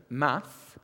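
"math" said the American way, no s. Shown as a demonstration. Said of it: "you call it".

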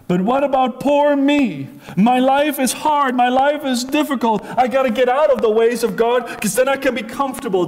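lightly mocked whining voice; whiny complainer. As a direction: play the moaning believer feeling sorry for himself. Mocking complaining believer.

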